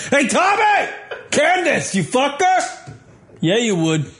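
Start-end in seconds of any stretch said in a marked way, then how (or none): 0.1-2.9 high laughing yell; laughing shout. Real, not acted. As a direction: shout at the TV, cracking up.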